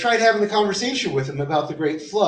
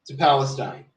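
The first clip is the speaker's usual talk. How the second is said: ordinary delivery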